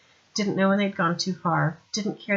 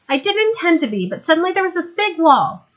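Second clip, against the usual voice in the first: put-on higher, louder young woman, brisk; young woman's voice; 10 semitones higher, 8 dB louder than usual. Sandra explaining to Vimes how she got there, higher young woman voice. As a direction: play her bright and eager.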